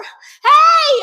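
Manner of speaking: high, very loud call for attention; shouting. A narrator's reading. Child stuck up on crutches calls out, shouting. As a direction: yell it out in alarm. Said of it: "I shouted".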